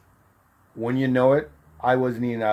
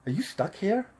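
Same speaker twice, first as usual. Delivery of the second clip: higher, soft retold question; imitated young woman; 7 semitones higher, 6 dB quieter than usual. Girl in line behind him asks, in a higher voice. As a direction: lightly voice a woman asking, pitched up. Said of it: "She goes, um".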